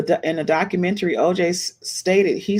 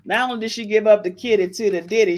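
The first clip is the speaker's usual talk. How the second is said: ordinary talk, nothing marked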